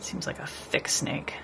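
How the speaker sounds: whispered aside to herself. Real, not acted. Painter quietly judging her snake drawing, whispering. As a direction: breathe it, barely there.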